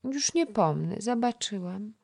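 put-on a chattering little girl coaxing the boy to play; little girl Krysia. (wysoki głosik małej dziewczynki)

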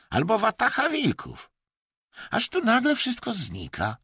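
very high-pitched character voice; Chinese hunter, insistent. A narrator's reading. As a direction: recount the dangers in a high, insistent voice. (wysoki głos postaci, z przejęciem)

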